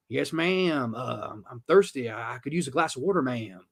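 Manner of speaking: meek Southern gardener asking softly; soft mock Southern voice, trailing off on "ma'am"; Southern accent. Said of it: "Southern accent".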